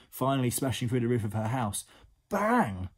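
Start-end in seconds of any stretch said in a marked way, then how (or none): 2.3-2.9 loud, high "bang" falling away; explosive storybook bang; exclaimed crash. A narrator's reading. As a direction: shout it like an impact.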